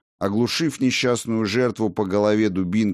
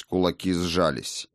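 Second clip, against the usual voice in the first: plain reading voice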